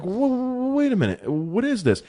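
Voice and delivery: high-pitched startled reaction; retold "hold on" bewilderment; acted disbelief. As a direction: pull up short, baffled. Acts out own startled reaction.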